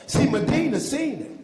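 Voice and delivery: high, loud preaching burst; excited shout. Real, not acted. Preacher gets turned up.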